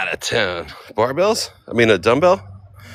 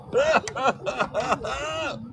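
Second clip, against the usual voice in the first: laugh; 6 dB quieter than usual. Real, not acted. Failing to lift barbell.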